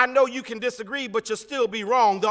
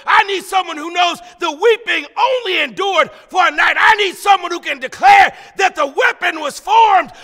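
rally the congregation, louder and higher toward the end; full-force preaching; 3 semitones higher, 6 dB louder than usual. Real, not acted. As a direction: build to a high, shouted sermon climax. Preaching builds to a climax.

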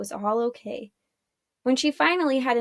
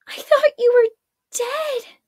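high, loud cry of disbelief; acted shock in a much higher voice; 13 semitones higher, 8 dB louder than usual. A narrator's reading. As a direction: blurt it out high-pitched, stunned. Shocked to find him alive.

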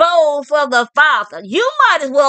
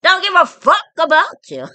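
ordinary talk, nothing marked

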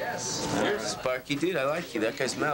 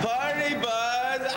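party cheer; 10 semitones higher, much slower than usual. Acted.

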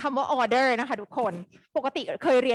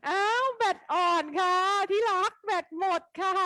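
mock dismay; 7 semitones higher than usual. Real, not acted.

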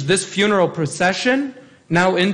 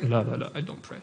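low, much softer reluctant mumble; mock refusal; 8 semitones lower, 11 dB quieter, faster than usual. Priest imitating a churchgoer refusing to pray, mumbling. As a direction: mumble the excuse like someone dodging a request.

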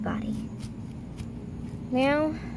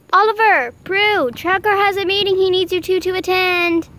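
calling out; 5 semitones higher, 4 dB louder than usual. Acted. Calling out to Oliver and Prue.

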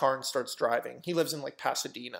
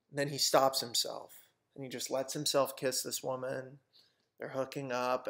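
usual speaking voice

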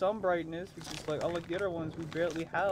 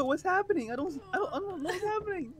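panicky; 10 semitones higher than usual. Real, not acted. Lost in dark horror game.

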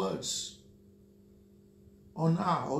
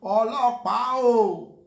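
loud, higher called-out Ọlọ́pàá o; mock catcall shout; 5 semitones higher, 10 dB louder than usual. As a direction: call Ọlọ́pàá o across the street, loud. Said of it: "when they say".

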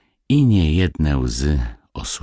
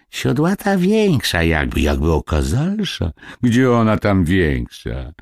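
animated, puzzled gossip voice, often pitched up; gossiping village women; 6 semitones higher, 3 dB louder than usual. Acted. Village gossips puzzle over the geese, in marvelling tones. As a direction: chatter in wonder like peasant neighbours.

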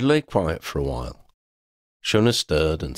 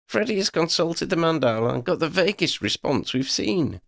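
high-pitched leader's voice; brief the companions in a raised pitch; Lydia, briefing the team; 10 semitones higher than usual. Acted.

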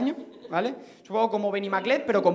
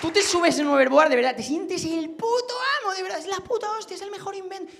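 overblown rave; 9 semitones higher than usual. Acted. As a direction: gush in a squeezed high voice. Over-the-top rave about hoverboards.

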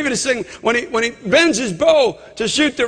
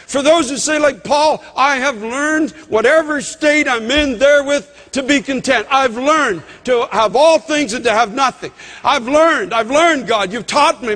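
higher, ringing preacher voice; fervent preaching; 3 semitones higher than usual. Acted. Pastor urging folks toward God's way, fervent preaching. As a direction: lift the whole church with it.

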